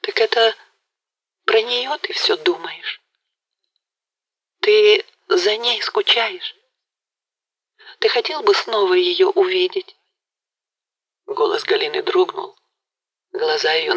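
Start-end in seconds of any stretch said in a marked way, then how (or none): 0.0-10.0 put-on young woman's anxious voice. A narrator's reading. Galina asks Mazepa about the other woman, hushed and uneasy. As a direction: ask timidly, afraid of the answer.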